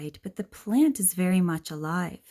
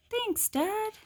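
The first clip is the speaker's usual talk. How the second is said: high, bright-voiced kid saying thanks; little child; 13 semitones higher than usual. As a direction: thank Dad like a small child.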